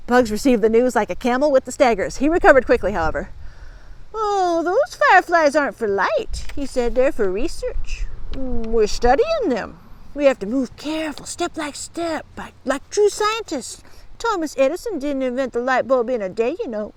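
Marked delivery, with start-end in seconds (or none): 4.1-6.5 high, slow, improvising bully; bluffing bully. A narrator's reading. Bugs recovers from being caught out, bluffing. 6.9-16.9 bluffing bully. A narrator's reading. Bugs improvising an excuse.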